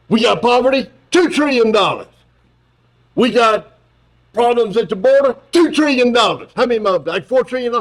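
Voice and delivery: mocking Biden pitching trillions; mocking Joe Biden. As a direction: rattle off problems in a hammed-up Biden. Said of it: "I mocked Joe Biden in ... this way".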